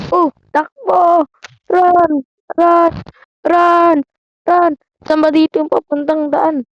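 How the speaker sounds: panicked high shouts to flee; while running. Real, not acted. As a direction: yell frantically while escaping. Fleeing monster in horror game. (sigaw habang tumatakbo)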